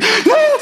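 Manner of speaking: high-pitched imitated panic gasp; hyperventilating gasp. Daughter pulled from the water, mimicked hyperventilating. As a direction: suck in air like a terrified child. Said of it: "She's hyperventilating at this point".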